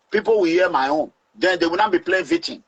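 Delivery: normal talking voice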